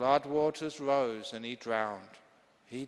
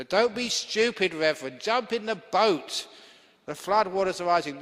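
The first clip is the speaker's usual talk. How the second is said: urgent plea; 6 semitones higher, 4 dB louder than usual. Acted. Rescuers urging the stubborn priest.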